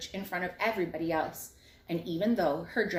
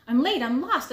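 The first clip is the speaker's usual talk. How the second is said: high loud cry of late and lost; yelling; 6 semitones higher, 7 dB louder than usual. A narrator's reading. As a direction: call out in a panic. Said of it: "She was yelling".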